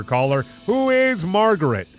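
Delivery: ordinary talk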